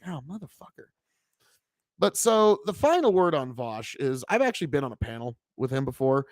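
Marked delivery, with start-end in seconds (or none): 0.0-0.9 muted dismay. Retold or imitated. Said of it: "It's like".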